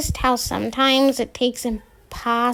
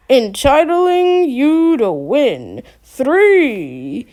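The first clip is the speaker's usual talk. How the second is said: slow, drawn-out game show announcer; hammy radio host; 3 semitones higher, 5 dB louder, much slower than usual. Acted. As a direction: stretch it out like a prize reveal. Fake radio host continues.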